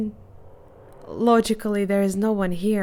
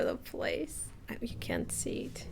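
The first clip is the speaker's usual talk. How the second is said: hushed whispered aside, much softer; 11 dB quieter than usual. Real, not acted. Streamer whispering to viewers about the cat, soft whisper. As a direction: breathe it to the viewers like a secret.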